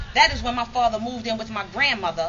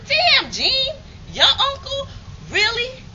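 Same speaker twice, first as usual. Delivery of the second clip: much higher, louder, squealing disbelief; shocked visitor; 6 dB louder, slower than usual. Retold or imitated. Visitors learning Gene is her uncle, squealing disbelief. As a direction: gasp it out like gossip just dropped.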